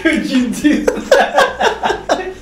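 slow, high words through laughter; laughing. Real, not acted. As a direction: say it while cracking up. Repeats line through laughter.

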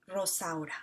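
usual speaking voice

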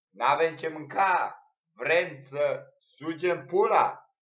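mock shout. Acted shouting reply.

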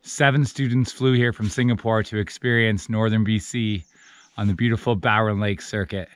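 low, even trip narration; voiceover narration. A narrator's reading. Narrator introduces an eight-day canoe trip to viewers, low voiceover.

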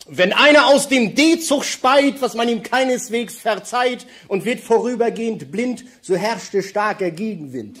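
mock-solemn poem. Reciting a comic rhyme.

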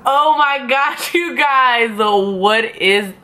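gasping oh my gosh, slow and high; shocked high outcry. Real, not acted. Seeing her blind-made-up face, high shocked cry. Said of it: "I'm crying".

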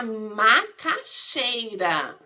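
"macaxeira" drawn out in separate pieces; slow right down and split "macaxeira"; syllable by syllable. Shown as a demonstration. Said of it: "começa com a sílaba ma".

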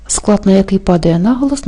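ordinary delivery

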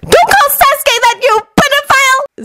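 high-pitched angry outburst; shrieky high indignation. A narrator's reading. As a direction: scold furiously in a high girl voice. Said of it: "Sakura said angrily".

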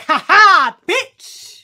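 loud taunting laugh, then high kicker; hammed-up gloating cackle and jab; mock gloating. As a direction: crow it in triumph. Gloating at the mama.

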